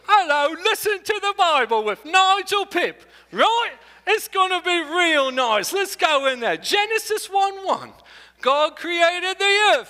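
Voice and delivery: high, slow, hammed-up British accent; mock a goofy British radio host reading the Bible; over-the-top British accent reading scripture; silly British accent. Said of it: "any British accent".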